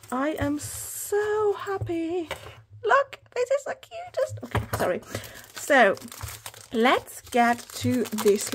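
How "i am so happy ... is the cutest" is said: high, drawn-out squeal building higher; gushing delight. Real, not acted. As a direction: gush slowly, rising into a squeal. Gushing over pouch, then apologises.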